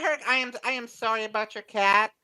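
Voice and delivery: ordinary talk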